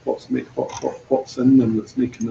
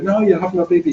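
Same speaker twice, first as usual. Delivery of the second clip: higher, bright chirpy congratulation; cheery well-wisher; 7 semitones higher, faster than usual. Retold or imitated. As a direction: gush at her like an aunt. Others cheerfully telling pregnant women.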